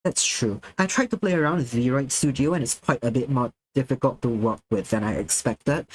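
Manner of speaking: ordinary talk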